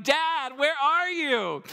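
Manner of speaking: put-on high, worried call of a lost boy; a mock-panicked six-year-old crying out; panicked child. Son calling for his hidden father, mock-panicked and high. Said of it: "he gets a little bit more panic".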